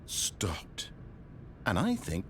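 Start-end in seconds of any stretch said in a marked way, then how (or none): 0.0-0.9 exhausted whisper; barely voiced, pleading. Narrator pleading, breaking down.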